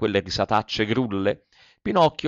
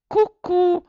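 very high squeaky teasing cucù; mocking cuckoo. A narrator's reading. Pinocchio goading the boys, a very high mocking cucù. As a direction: sing it right up high to goad them. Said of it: "in segno di canzonatura".

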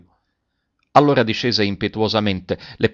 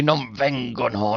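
slow, softer strained cry; through clenched teeth; 6 dB quieter, slower than usual. A narrator's reading. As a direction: force it slowly through the teeth. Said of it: "gridava con voce smozzicata fra i denti".

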